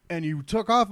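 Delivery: usual speaking voice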